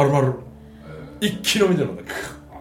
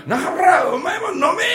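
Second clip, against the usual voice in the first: put-on 1 drunk command; 12 semitones higher, 4 dB louder, slower than usual. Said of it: "とか言ったら".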